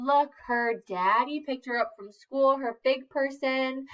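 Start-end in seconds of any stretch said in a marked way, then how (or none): none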